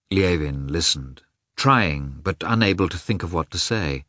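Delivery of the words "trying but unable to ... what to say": plain reading voice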